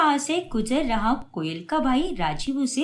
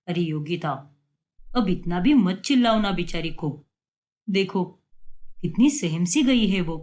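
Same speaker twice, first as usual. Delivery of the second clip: a hint of a calm father, lower voice; easygoing dad smoothing things over; lightly voiced father; 6 semitones lower than usual. Father soothing his wife who scolds their daughter, lower and calm.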